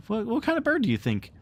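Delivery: put-on eager boy. Boys question Nibs, faster.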